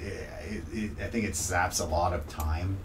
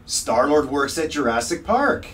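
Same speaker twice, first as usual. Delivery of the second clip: high, loud and slow, mock-amazed daughter; excited child quote; 6 semitones higher, 5 dB louder, slower than usual. Dad imitating his daughter's loud, amazed discovery. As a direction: announce it like a big discovery. Daughter's line voiced in story.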